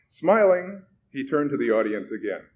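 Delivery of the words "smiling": ordinary delivery, nothing marked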